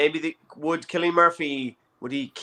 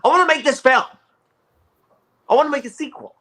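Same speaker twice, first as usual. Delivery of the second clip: much higher, louder silly director voice; mock Tarantino; 12 semitones higher, 6 dB louder, faster than usual. Imitating Tarantino begging to make the film, high and loud. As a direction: gush like a starstruck director.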